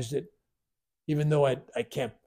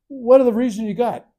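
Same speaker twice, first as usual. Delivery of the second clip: demanding challenge; 9 semitones higher, 10 dB louder than usual. Retold or imitated. Demanding reasons from guru.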